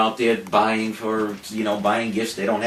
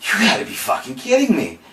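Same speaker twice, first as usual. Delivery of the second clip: high, incredulous outburst; louder disbelief; 7 semitones higher than usual. Retold or imitated. To the Best Buy manager, high incredulous outburst. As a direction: hit it higher, really can't believe it. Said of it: "I said again".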